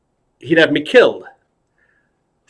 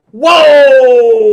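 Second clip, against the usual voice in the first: loud, high, long drawn-out whoa; amazed whoa; 12 semitones higher, 9 dB louder than usual. Acted. Two stars react to the clown, loud mock-amazed whoa. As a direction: hold a big high cry of wonder. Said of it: "he and De Niro go".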